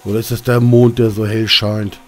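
usual speaking voice

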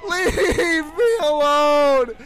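slow, drawn-out sobbing yell; mock crying shout. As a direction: wail it like a haunted doll in tears. Repeating line, crying.

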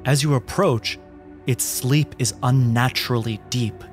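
ordinary talk

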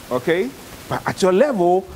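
ordinary talk

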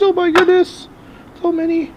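high squeal of delight at the pile; surprised squeal. Real, not acted. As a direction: gasp it out way up high. Reacting to a pile of cards.

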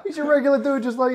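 laughing. Retold or imitated. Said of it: "I said".

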